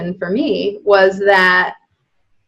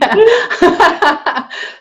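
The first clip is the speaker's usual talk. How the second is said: laughing; 10 semitones higher than usual. Real, not acted. Laughs at being a crier.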